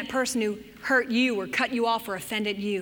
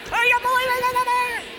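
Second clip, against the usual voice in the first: much higher, louder outraged cry; hammed-up indignant wail; mock fury; 10 semitones higher, 4 dB louder, faster than usual. Shown as a demonstration. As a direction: keep hamming up the grievance. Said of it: "Okay, get angry".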